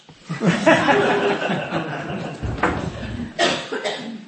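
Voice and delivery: a real burst of laughter at his own joke; real unvoiced laughing fit; breathy laugh. Real, not acted. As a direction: laugh out loud and let it ride.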